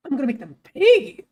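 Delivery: threat that cracks upward on "pay". Real, not acted. Streamer trying to sound intimidating, voice cracking on "pay". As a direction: voice breaks on the last word. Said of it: "Oh, the voice crack".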